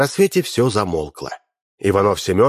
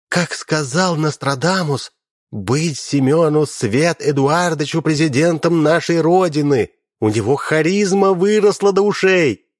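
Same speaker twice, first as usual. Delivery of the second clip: excited; 7 semitones higher than usual. A narrator's reading. Fawning woman praising leader.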